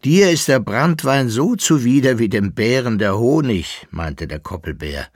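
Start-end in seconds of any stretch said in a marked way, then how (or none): 0.0-3.9 lightly voiced character, louder and higher; lively retort. Koppelbär retorts to his companion about the brandy, lively and emphatic.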